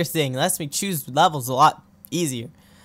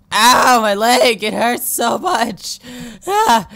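mock wounded cry, drawn out slowly; hammed-up pain; 5 semitones higher, 5 dB louder, slower than usual. Gamer hamming up the character's injury, mock wail. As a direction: overact the hurt leg like a bad actor.

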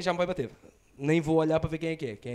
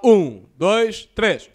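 louder, punchier count; loud countdown; 12 dB louder, much slower than usual. Shown as a demonstration. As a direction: belt out the count. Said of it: "vou dizer um, dois, três".

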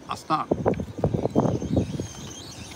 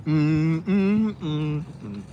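chewing; 3 semitones lower, 6 dB quieter, much slower than usual. Real, not acted. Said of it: "let me chew my food".

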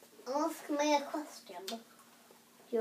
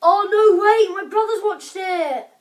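loud high "no way"; shocked protest; 5 semitones higher, 13 dB louder than usual. Real, not acted. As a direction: cry out in disbelief. Protests in disbelief.

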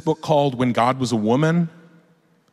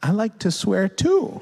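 put-on higher, lilting admission to a child; sweetly confess, rising at the end; parent confiding; 7 semitones higher than usual. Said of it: "And I'm like".

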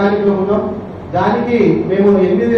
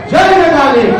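loud high slogan cry; rallying slogan shout; 6 semitones higher, 5 dB louder than usual. Real, not acted. Association member rallying the crowd, loud slogan shout. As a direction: raise the crowd with a cheer.

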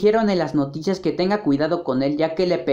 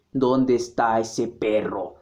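low, slow and menacing; deep slow voice; 10 semitones lower, 4 dB quieter, slower than usual. Acted. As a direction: drag it out low and heavy. Second character asks same line.

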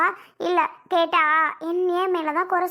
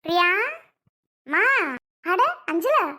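mother-in-law greeting; 2 semitones higher than usual. Acted. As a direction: call out a surprised welcome in a different voice. Voices the welcoming mother-in-law.

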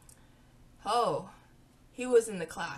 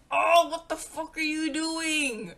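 higher, louder, slow outraged yell at him; 6 semitones higher, 5 dB louder, slower than usual. Retold or imitated. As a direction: snap at the guy who hit you. Retelling being slapped.

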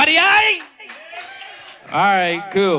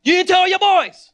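exasperated call-out; 11 semitones higher, 4 dB louder than usual. Retold or imitated. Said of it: "So I'm now me to the other guys".

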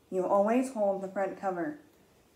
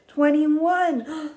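excited; 7 semitones higher, 7 dB louder than usual. Shown as a demonstration. Counting climax.

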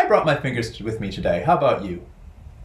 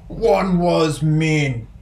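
slow, mock-gruff mean bird; 6 semitones higher, 6 dB louder, much slower than usual. Shown as a demonstration. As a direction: play the mean one for kids. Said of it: "mean bird voiced".

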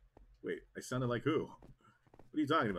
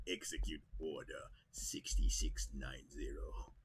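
lower, slower, missing the rasp; failed Palpatine voice; 9 semitones lower, 9 dB quieter than usual. Acted. Gamer attempting the voice again, off the mark. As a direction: try the dark lord again, not quite. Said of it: "it doesn't sound the same".

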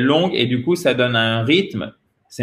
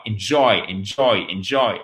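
"enjoy" repeated as in-JOY; short "in", strong "JOY" in enjoy; stress on second syllable; 3 semitones lower than usual. Shown as a demonstration. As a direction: drill "enjoy" with weight on JOY. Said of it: "Accent tonique sur la deuxième".

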